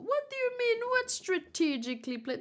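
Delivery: high, sharp disbelieving question; real indignant surprise; incredulous. Real, not acted. Reader protests a friend's joke about a speech bubble, incredulous.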